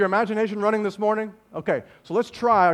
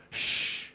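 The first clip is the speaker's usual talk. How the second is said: soft, unvoiced shush; acted hush to quiet a storm; hushing sound; 9 dB quieter than usual. Jesus tells the storm to stop, hushing.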